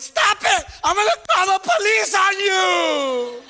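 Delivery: high crying shout; panicked yell. Retold or imitated. Kid to mom about to hit him, crying shout. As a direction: scream it out in panic, slow and loud.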